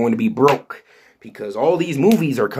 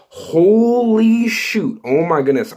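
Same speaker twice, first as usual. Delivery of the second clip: excited outburst; 9 semitones higher, 5 dB louder, slower than usual. Real, not acted. Reacting to Blade release rumor.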